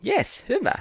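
normal talking voice

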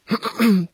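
nervous little throat clear. Acted. Boy about to phone the organiser, clearing his throat. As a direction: clear the throat before speaking up.

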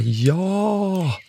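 put-on high eager shout of many kids; children shouting. A narrator's reading. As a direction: cry out like a group of excited children. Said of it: "Alla barnen ropar".

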